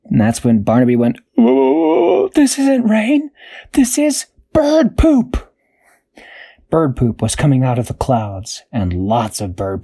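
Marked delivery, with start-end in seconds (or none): 1.4-5.5 high, fussy little cat hating the rain; high complaining cat; whiny cat voice. Acted. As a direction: whine it like a cat who hates getting wet. Cat Barnaby discovers poop rain.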